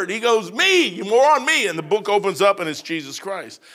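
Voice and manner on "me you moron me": exasperated retort. Retold or imitated. Jesus answering the preacher.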